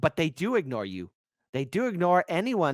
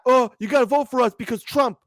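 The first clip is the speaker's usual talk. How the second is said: mocking; 8 semitones higher, 5 dB louder than usual. Mocking Democrats' pitch.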